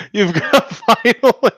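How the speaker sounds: drawn-out laughing repeat. Real, not acted. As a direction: laugh through the words.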